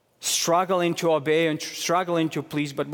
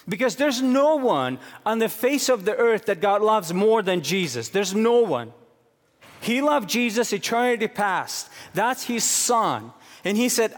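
preaching at full force; 6 semitones higher than usual. Acted. Pastor preaching to congregation about God's love, fervent and high. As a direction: preach it hard with rising passion.

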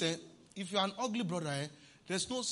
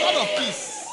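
higher, louder shouted refrain; 10 semitones higher, 8 dB louder, slower than usual. Real, not acted. Preacher shouting his refrain to the congregation, loud. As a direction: lift it up for the church.

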